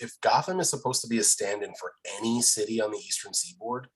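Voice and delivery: ordinary talk, nothing marked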